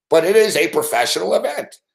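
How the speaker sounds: ordinary talk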